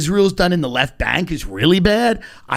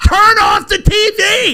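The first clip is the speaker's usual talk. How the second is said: sudden high shout; escalating comedy rant; 13 semitones higher, 5 dB louder, slower than usual. Acted. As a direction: yell it, exasperated. Ranting about ignoring the news.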